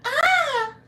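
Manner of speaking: ex-husband's falsetto shriek, mimicked; loud mock scream shooting way up high; imitated high-pitched scream. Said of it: "his voice went up five, six octaves".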